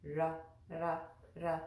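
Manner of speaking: "Ra" with tongue just behind the upper teeth; tongue-tip ra. Shown as a demonstration. Teacher drilling the r sound. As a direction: tap "Ra" lightly, near a rolled r. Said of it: "that's where we wanna place the tongue".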